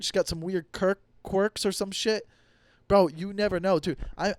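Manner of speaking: normal talking voice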